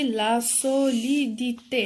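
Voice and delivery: "لا سوليديتي" in slow, separated syllables; each syllable of "لا سوليديتي" drawn out; syllable-by-syllable sounding out. Shown as a demonstration. Sounds out the new noun.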